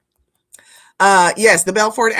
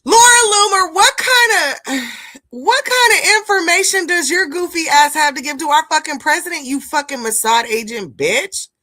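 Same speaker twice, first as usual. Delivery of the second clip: high, loud, exasperated yelling at the screen; furious outburst; 8 semitones higher, 4 dB louder than usual. Real, not acted. Streamer yelling at Loomer clip on screen, furious and loud. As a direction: blow up at her, real outrage.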